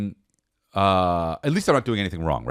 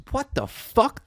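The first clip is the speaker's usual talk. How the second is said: shocked; 12 semitones higher, slower than usual. Acted. Shocked at the ladder.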